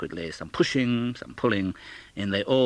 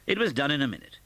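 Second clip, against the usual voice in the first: ordinary delivery, nothing marked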